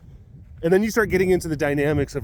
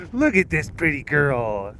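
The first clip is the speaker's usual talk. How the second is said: slow, drawn-out doting coo; cooing pet talk; slower than usual. Real, not acted. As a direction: fuss over an adored pet. Cooing to a pet mid-show.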